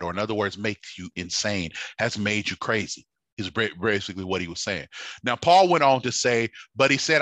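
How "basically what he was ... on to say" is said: ordinary delivery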